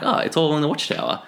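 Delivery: ordinary delivery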